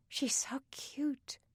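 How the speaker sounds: put-on slow, soft girlish whisper up high. A narrator's reading. Beth to Denny about the new little girl at bedtime, whispered. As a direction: whisper it slowly, softly gushing. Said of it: "Beth whispered".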